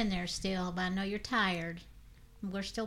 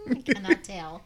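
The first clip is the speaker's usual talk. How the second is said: words through laughter; laughing; 12 semitones higher, 6 dB louder than usual. Real, not acted. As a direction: laugh while saying it. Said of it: "What are you laughing at?".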